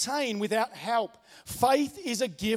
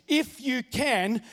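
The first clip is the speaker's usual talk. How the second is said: slow pleading quote; 3 semitones higher, much slower than usual. Retold or imitated. Father's plea quoted slowly.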